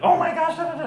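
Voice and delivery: high, loud mock panic; hammed-up hysterical mother, shrill pitch leap; over-the-top freak-out. Imitating his mom at the accident, over-the-top freak-out. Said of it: "taking it over the top".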